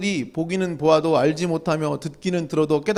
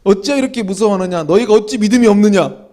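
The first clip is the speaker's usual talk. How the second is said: rebuking; 3 semitones higher, 8 dB louder than usual. Acted. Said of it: "혼내".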